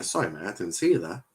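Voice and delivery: ordinary talk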